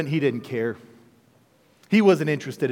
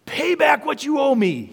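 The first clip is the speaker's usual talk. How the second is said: a hint of a harsh demand, higher; lightly voiced servant choking a debtor; 9 semitones higher than usual. Servant choking his fellow servant for money, mildly harsh. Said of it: "he demanded".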